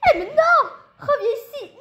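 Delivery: father yells high and loud, begging her to come back; shouting after her. Acted. Father shouting after daughter who runs off with boyfriend, panicked yell.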